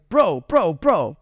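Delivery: lightly voiced big Middle Eastern dude; pleading bro, bro, bro; imitating his friend. Store owner calming the angry customer, mild imitation. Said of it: "Middle Eastern guy, big dude".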